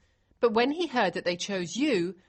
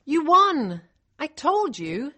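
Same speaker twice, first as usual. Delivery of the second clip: high, loud triumphant announcement; excited; 5 semitones higher, 6 dB louder, slower than usual. Acted. Liz tells the girl she won, excited. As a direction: burst out with the great news.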